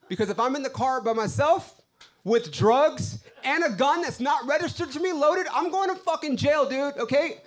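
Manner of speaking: high, loud indignant rant; pissed off. Acted. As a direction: vent the outrage, climbing in pitch. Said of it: "I'm pissed in this moment".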